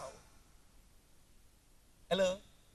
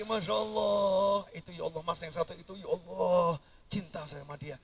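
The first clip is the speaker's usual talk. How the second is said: lovesick sigh; 4 semitones lower, 9 dB quieter than usual. Acted. Mock lovesick gaze.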